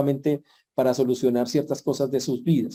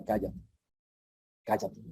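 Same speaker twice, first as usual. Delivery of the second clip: low, much softer command to be quiet; stern hush; 4 semitones lower, 11 dB quieter than usual. Acted. Jesus silencing the demons with a soft, low hush. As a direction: hush them firmly under your breath.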